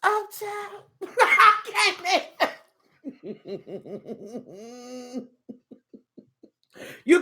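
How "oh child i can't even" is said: high, helpless laughing talk; cracking up. Real, not acted. Overcome by a viewer's comment, cracking up. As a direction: laugh through it until the words fade away.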